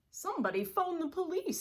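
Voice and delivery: a papa bear calling for help; fast high urgent call; urgent father bear. Acted. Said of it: "said Mister Blair".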